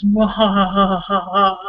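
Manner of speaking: slow villain mwahahaha; evil laugh. Acted. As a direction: cackle like a cartoon villain. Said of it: "Evil laughter".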